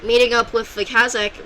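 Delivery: usual speaking voice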